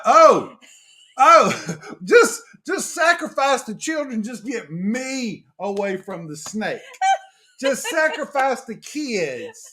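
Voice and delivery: high mock panic, begging to escape; hammed-up cry to save herself first; mock panicked wife. Husband mimicking wife fleeing the snake, mock panic. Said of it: "She's like".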